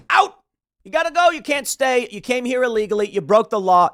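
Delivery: loud, high-pitched dismissal; mock outburst. Imagined debater answers on immigration, loud mock outburst.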